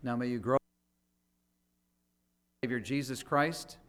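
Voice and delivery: ordinary talk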